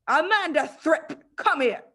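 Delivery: high, loud, slow bellow across the playground; shouting. Acted. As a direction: roar the girl's name like a drill sergeant. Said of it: "she was shouting".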